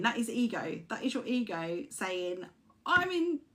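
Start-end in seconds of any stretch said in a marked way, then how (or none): 2.8-3.4 lightly voiced high stubborn ego; mock ego. Speaker voicing an ego refusing to back down, high. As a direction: dig in, won't lose face.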